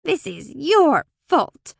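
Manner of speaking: high, loud, drawn-out blame; angry accusation. A narrator's reading. Brownie behind the rose bush blames Alora, angrily accusing. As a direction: stretch out each word accusingly. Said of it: "Alora's eyes opened wide at the accusation".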